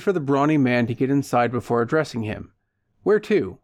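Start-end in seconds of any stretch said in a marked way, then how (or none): 3.0-3.6 loud, high question; loudly. Acted. Driver asks his passenger loudly where to. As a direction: call it out over the back seat. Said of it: "he asked loudly".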